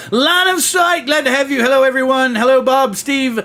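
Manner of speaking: high-pitched, sustained hello; excited roll-call greeting. Real, not acted. Calling out greetings to chat arrivals.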